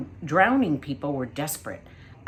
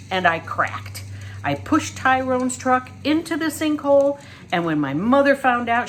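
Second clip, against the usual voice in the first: louder and higher, building indignation; proud boy insisting on his name; dramatic boy reciting his grievance; William Spiver, aggrieved; 4 semitones higher, 5 dB louder than usual. A narrator's reading.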